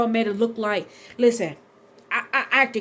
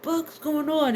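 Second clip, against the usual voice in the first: startled dad; 5 semitones higher, slower than usual. Retold or imitated. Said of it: "My, my dad's like".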